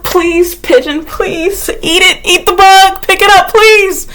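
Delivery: high, pleading, slow begging. Retold or imitated. Begging the pigeon to eat the bug, a high plea. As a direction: beg the pigeon, half laughing. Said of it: "Yeah, I was like".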